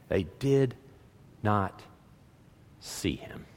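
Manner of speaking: word by word with long gaps, fading low; low and soft, breaking off between words; hushed, halting. Acted. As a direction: let it sink away softly. Repeats the line to sink in.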